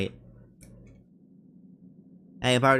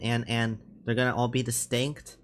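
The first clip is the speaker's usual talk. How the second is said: usual speaking voice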